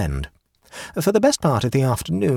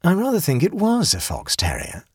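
plain reading voice

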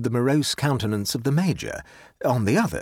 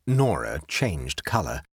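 ordinary delivery

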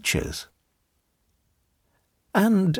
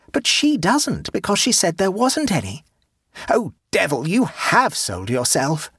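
gleeful accusation; 6 semitones higher than usual. A narrator's reading. Boy accusing the aunt of lying, gleefully. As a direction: gloat as he exposes her.

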